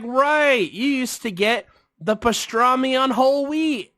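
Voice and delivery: high, gushing imitation; mock excited recall. Woman recalling customer's order, high mock excitement. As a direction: exclaim high as the remembering woman.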